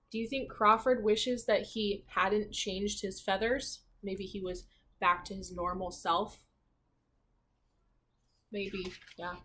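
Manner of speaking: plain reading voice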